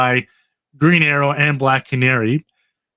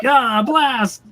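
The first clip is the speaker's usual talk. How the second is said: hammed-up "Ah, blast!" pitched way up; a comic-book hero cursing his luck, played big; mock frustrated exclamation; 9 semitones higher than usual. Heroes caught by the aging ray, hammed-up comic curse.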